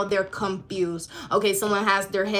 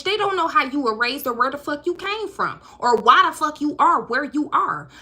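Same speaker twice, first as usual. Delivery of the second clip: ordinary talk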